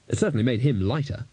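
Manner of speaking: ordinary delivery, nothing marked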